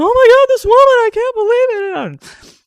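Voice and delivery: hammed-up tearful disbelief; overwhelmed wail. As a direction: wail it out, overcome. Acting out coming home overwhelmed.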